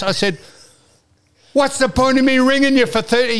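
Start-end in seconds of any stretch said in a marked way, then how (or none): 1.5-3.4 high, loud re-enacted exasperation; mock outburst of a fed-up caller; retold raised voice. Caller challenges phone clerk on the point of ringing, loud retold protest. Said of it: "the way you raised your voice".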